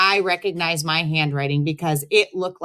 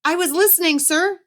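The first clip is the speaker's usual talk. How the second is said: put-on high-pitched young boy pleading; high kid voice; 12 semitones higher than usual. A narrator's reading. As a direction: play the embarrassed kid, voice up high. Kid answers principal.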